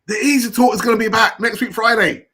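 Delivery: buzzing to announce the show is back; excited. Real, not acted. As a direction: hype up the show's return. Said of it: "I'm more excited for that".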